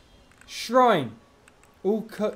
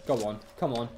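ordinary talk, nothing marked

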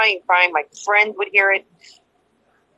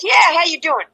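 higher, louder mimicry of his greeting; lightly mocked caller, pitched up; imitated ex; 7 semitones higher, 5 dB louder than usual. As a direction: play him insisting on the question. Acting out ex on phone.

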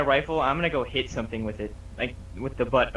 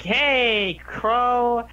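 much higher, bright friendly call; cheery high greeting; 8 semitones higher, 3 dB louder, slower than usual. Acted.

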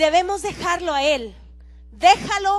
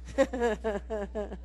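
soft chuckle; 7 semitones lower, 7 dB quieter than usual. Real, not acted. Laughs at own joke.

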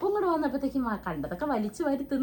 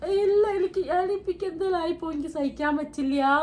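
retold crying plea, higher, slowing at the end; 6 semitones higher, 3 dB louder than usual. Telling her brother about chest pain, crying. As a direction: reenact begging through tears. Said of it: "ഭയങ്കര കരച്ചിലൊക്കെ".

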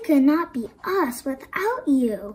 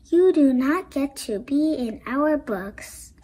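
plain reading voice

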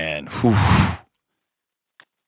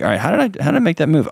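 higher, puzzled self-talk; curious self-questioning; 6 semitones higher, much faster than usual. Acted. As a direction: quickly wonder aloud at your own slip. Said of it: "It's a posture of curiosity".

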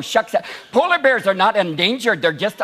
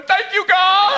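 relieved cry; 10 semitones higher, slower than usual. Retold or imitated. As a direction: shout it up to heaven. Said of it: "I was going".

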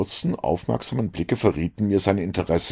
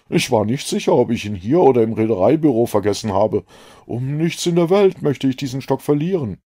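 put-on slightly higher, earnest young doctor; a keen, relieved young man; a lightly voiced eager doctor; 6 semitones higher, 5 dB louder than usual. A narrator's reading. Relieved doctor to Holmes, slightly raised voice.

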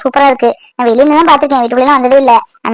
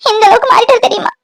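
high-pitched tearful reproach; crying; 10 semitones higher than usual. Acted. Sister reproaches her long-missing brother, crying. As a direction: sob the complaint out, voice pitched way up. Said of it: "Divya, அழாத திவ்யா".

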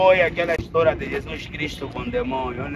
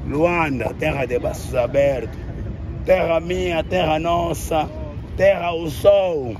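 poetic recitation; 3 semitones higher, 4 dB louder than usual. Acted. Poetic ode to Luanda.

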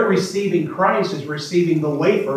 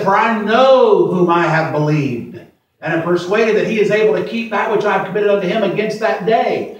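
scripture proclamation; 4 semitones higher, 4 dB louder than usual. Acted. Quoting Paul.

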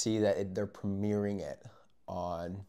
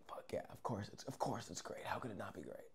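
very soft, under-the-breath reply; hushed, retold confidence; hushed self-assurance; 12 dB quieter than usual. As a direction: reenact a cocky answer almost in a whisper.

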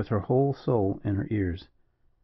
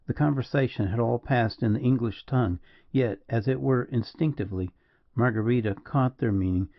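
ordinary delivery

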